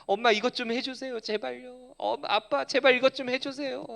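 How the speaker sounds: a hint of a tearful kid asking a parent; imitating a crying child pleading with mom and dad. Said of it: "내 자녀가 막 눈물을 흘립니다".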